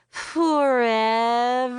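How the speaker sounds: drawn-out mock-dramatic "Forever?"; overly dramatic. A narrator's reading. Best friend teasing about the long online chat, drawn out dramatically. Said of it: "taking an overly dramatic deep breath before adding".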